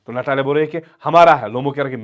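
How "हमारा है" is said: louder snapped claim; loud retort. Retold or imitated. Natalia trading claims with Lomov, louder. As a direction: hammer the word home. Back-and-forth ownership quarrel.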